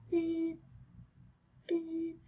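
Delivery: high mimicked beep beep of a phone. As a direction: imitate the dial tone, high and soft. Imitating phone ringing tone.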